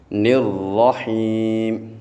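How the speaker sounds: slow نرحیم with unrounded lips; correct pronunciation. Shown as a demonstration. Teacher repeating the correct form. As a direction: say نرحیم straight, no واو. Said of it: "ہونٹوں کو گول نہیں کرنا".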